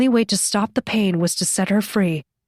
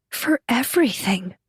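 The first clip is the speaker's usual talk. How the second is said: "everything" emphasized, pitched up; stress on everything; 3 semitones higher than usual. A narrator's reading. Rescuer apologizes to her friend, stressing "everything". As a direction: lean on the word "everything". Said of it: "She emphasized the last word".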